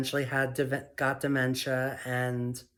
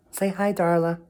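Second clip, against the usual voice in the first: louder, higher coaxing to the cat; pet talk; 5 semitones higher, 8 dB louder than usual. Real, not acted. Asking Darla to greet viewers, high coaxing. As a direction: coax the cat sweetly.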